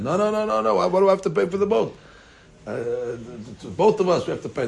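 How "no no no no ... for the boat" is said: high-pitched panicked no's, fast; screaming protest. Retold or imitated. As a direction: protest wildly, voice leaping up. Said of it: "Sasson screaming his head".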